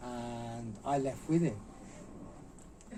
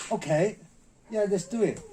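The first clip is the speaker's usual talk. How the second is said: loud, higher-pitched gung-ho imitation; retold game agreement; 6 semitones higher, 7 dB louder than usual. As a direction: agree loudly, psyching up for a punch. Imitates Jackie punching himself, agreeing.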